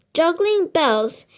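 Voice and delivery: plain reading voice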